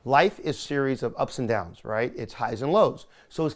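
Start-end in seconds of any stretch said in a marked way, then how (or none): none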